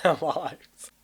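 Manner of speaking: normal talking voice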